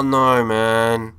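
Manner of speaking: ordinary talk, nothing marked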